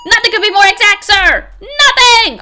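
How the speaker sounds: loud, high, frantic patrolman yelling sir; shouty patrolman. Acted. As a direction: bellow every line like a panicked rookie cop.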